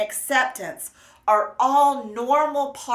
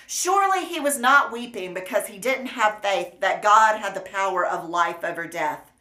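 ordinary talk, nothing marked

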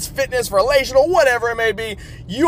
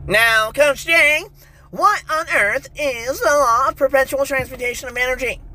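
curious listener voice; 5 semitones higher than usual. Pretend. Listener asks the coach.

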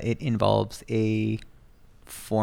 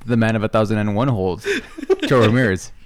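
announcer intro; 5 dB louder than usual. Acted. Copying announcer intro for cohost.

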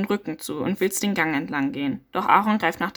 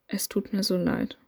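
quiet murmur; 5 dB quieter than usual. Acted. Muttering sorry over the dead stranger, softly murmured. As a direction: murmur it under your breath. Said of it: "murmelst leise vor dich hin".

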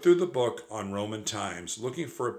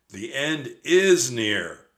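ominous; 4 semitones higher, 7 dB louder, much slower than usual. A narrator's reading. Quoting soothsayer ominously.

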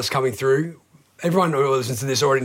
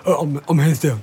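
imitating Hands Down. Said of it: "That's the best impersonation I've ever heard".

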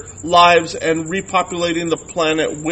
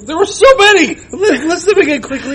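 laughing exasperation; 10 semitones higher, 5 dB louder than usual. Real, not acted. Laughing exasperation at many movies.

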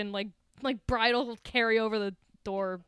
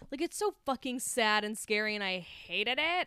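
ordinary delivery

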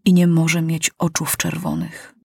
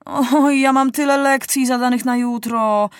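higher complaining moan; moaning footman; 6 semitones higher than usual. A narrator's reading. Footman moans to the king about homework, higher. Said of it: "jęknął jeden".

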